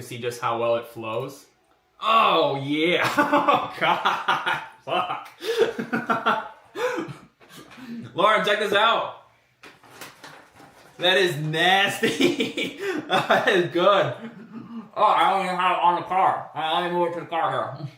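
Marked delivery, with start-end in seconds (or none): 2.0-5.3 delighted loud outburst; excited. Real, not acted. Excited reaction to car render. 5.3-8.1 high excited laugh; delighted laugh. Real, not acted. Reacting to render. 8.1-17.8 loud, higher, laughing delight; excited. Real, not acted. Excited, calling partner over.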